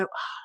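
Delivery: very quiet sighing ah; almost silent exhale of relief; soft release sigh. Shown as a demonstration. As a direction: breathe out a soft, letting-go ah. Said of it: "and then you go".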